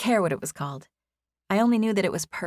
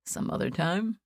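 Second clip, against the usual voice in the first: teasing question in his lighter voice; playful echo with a smile; smiling echoed question; 3 dB quieter than usual. A narrator's reading. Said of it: "he asked, smiling".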